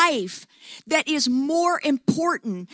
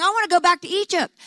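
quick, raised whiny complaint; mock whining; 6 semitones higher, 4 dB louder, much faster than usual. Israel grumbling to Moses in the desert, fast mock whine. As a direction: toss off the complaint fast like a sulky slave. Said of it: "saying and whining and complaining".

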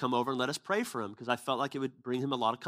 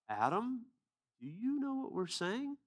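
incredulous question; 9 semitones higher, 6 dB quieter, slower than usual. Retold or imitated. Stunned speaker asks Adam.

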